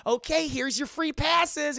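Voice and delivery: high, slow, loud put-on voice; acted museum staffer; cheery attendant. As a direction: sing out a welcome to visitors. Voices museum staff handing passes.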